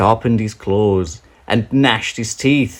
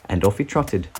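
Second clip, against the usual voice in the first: ordinary delivery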